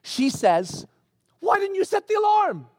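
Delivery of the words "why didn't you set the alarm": high-pitched imitation of a panicked wife; acted-out panic, pitched way up; mock panic. Wife to husband over the alarm, in mock panic. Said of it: "in a panic".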